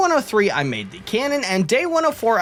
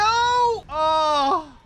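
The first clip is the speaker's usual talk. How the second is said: high anguished cry of loss; 10 semitones higher than usual. Real, not acted. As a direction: cry out as it all goes wrong. Creature dies.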